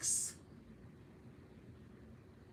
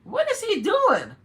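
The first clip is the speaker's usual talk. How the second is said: stunned disbelief; 11 semitones higher, 8 dB louder, slower than usual. Real, not acted. Shocked by his posts.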